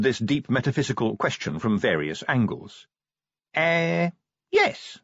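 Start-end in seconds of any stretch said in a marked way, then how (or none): 3.5-5.0 tentative, very high little answer; venturing. A narrator's reading. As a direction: venture it after careful thought. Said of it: "he ventured".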